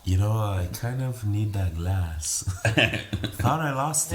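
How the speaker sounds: slow, drawn-out put-on caller; ex calling up. Ex phoning about her forgotten glass, mock casual request. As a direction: lightly voice the ex, dragging the words.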